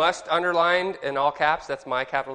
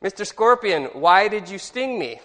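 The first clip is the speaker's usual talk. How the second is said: indignant protest; 6 semitones higher, 6 dB louder than usual. Retold or imitated. Stung frog confronts the scorpion.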